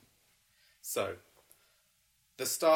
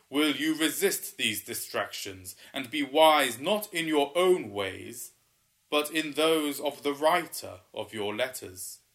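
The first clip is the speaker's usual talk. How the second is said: booming water spirit; 3 semitones higher, 6 dB louder than usual. Acted. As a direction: boom it out like an ancient river spirit. Waterman questions the pair.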